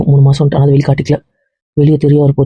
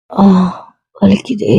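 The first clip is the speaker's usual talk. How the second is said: in pain; 5 semitones higher, much slower than usual. Acted. Woman in pain.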